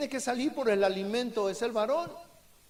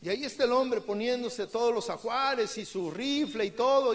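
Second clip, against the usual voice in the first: usual speaking voice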